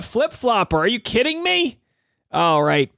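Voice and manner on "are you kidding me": mock disbelief. Real, not acted. Teasing co-host flip-flopping.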